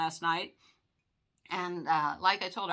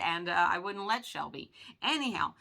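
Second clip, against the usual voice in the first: ordinary talk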